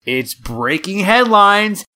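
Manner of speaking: high, slow breaking-news announcement; news anchor voice. Pretend. Announcing the headlines segment.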